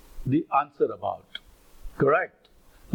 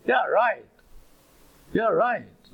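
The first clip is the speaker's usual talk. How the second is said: higher-pitched sarcastic "yeah, right", falling away; 5 semitones higher, much slower than usual. Shown as a demonstration. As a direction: demonstrate mild sarcastic disbelief. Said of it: "to sarcastically indicate that something is not correct".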